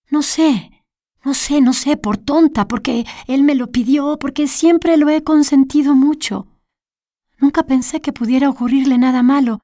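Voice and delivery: put-on upset older woman. Narrator voices distraught Olga.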